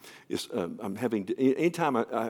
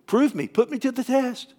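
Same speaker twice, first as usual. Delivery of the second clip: higher, pleading voice of God; God's voice; 11 semitones higher than usual. Acted. God challenging believers to test him, raised and urgent. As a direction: let God dare them to test him. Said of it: "He says".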